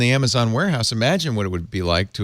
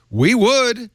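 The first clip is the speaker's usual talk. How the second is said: higher-pitched, earnest protest; quoted plant owner; 9 semitones higher than usual. Retold or imitated. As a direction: voice a manufacturer pleading a hiring problem, pitched up. Voices Texas plant owner's plea.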